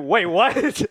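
high, loud confused "wait, what"; mock confused. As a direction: act baffled and shrill.

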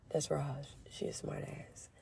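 unvoiced whispered aside about Raj. Real, not acted. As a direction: breathe it out as a sly aside, no voice at all.

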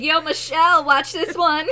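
high, loud show-off whoop; cocky husband showing off. Retold or imitated. Husband showing off to Michelle on the mountain, loud and cocky. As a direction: hype it up like a reckless driver. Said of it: "He's like".

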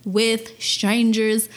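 slow, deliberate code explanation. Acted. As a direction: draw out each word of the code. Spelling out promo code.

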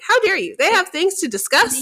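high-pitched theatrical outrage; mock indignant. Fan retelling her upset reaction to the interruption, mock indignant. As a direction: protest like a scandalized fan. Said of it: "I was so upset".

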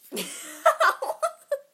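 squealing burst of laughter; high shrieking laugh. Real, not acted.

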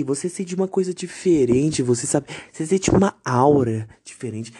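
ordinary delivery

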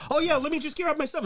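put-on high, keen little-boy voice; squeaky excited tagalong; eager high voice. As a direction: play him as a too-eager kid. Imitating eager Trump.

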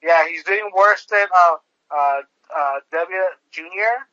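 normal talking voice

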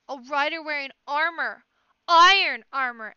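loud, high cry of warning; alarmed shout. A narrator's reading. Frightened crowd spotting an armored rider, alarmed shout. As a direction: call out the danger with mounting alarm. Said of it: "Frightened murmurs were drifting up from the crowd".

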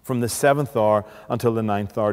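ordinary delivery

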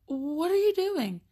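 high, slow disbelieving question; baffled mom. Retold or imitated. Mom baffled watching daughter copy the dog. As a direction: ask it in stunned disbelief.

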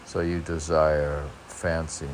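ordinary talk, nothing marked

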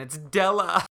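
loud, high breathless reveal; reading the ghost twist aloud, loud and gasping; dramatic reveal. Acted. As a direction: hit the twist hard.

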